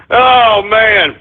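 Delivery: ordinary delivery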